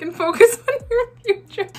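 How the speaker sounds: reading aloud, cracking up high and loud; breaking into laughter. Real, not acted. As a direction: lose it laughing midway through reading. Breaks up reading cousin's text.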